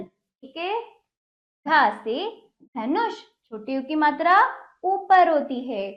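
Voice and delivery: ordinary delivery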